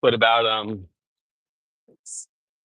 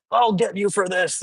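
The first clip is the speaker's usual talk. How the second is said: higher, hammed-up cartoon villain vow; playful mock villain; mock villain threat; 8 semitones higher than usual. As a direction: swear revenge like a silly bad guy. Opponent threatens him in jest.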